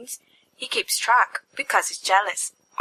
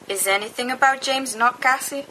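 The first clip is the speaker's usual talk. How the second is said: ordinary talk, nothing marked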